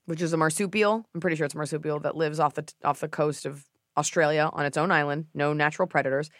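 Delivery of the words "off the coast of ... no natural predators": usual speaking voice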